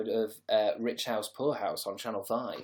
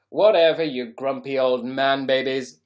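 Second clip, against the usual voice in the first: mocking jab; 2 semitones higher, 11 dB louder than usual. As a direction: bellow it out as a teasing scoff. Mocking grumpy viewers.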